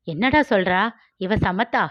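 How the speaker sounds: plain reading voice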